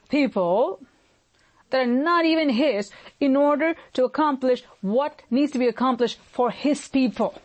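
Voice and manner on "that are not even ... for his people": ordinary talk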